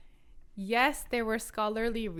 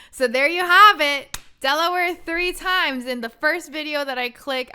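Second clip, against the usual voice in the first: loud, higher, emphatic punchline; triumphant reveal; 7 semitones higher, 13 dB louder than usual. Real, not acted. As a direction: belt out the proof like a big reveal. Triumphant punchline of her story.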